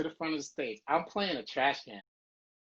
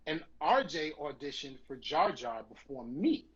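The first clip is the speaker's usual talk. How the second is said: ordinary talk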